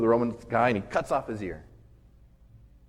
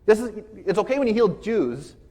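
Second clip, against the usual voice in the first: flabbergasted Peter; 5 semitones higher than usual. Retold or imitated. Peter reacts to Jesus healing the enemy, flabbergasted. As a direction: imitate a stunned follower, objecting harder and harder. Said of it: "Peter's more flabbergasted".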